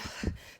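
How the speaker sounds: demonstrated breathless puff of air; out-of-breath puff. Shown as a demonstration. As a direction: huff as if out of breath. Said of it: "like you're out of breath".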